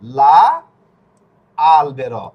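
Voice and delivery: teacher's emphatic two-part লা ... আলবেরো; pronunciation demo. Shown as a demonstration. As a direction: sound out "লা আলবেরো" with an A after the L. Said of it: "তাহলে এটা কি হবে?".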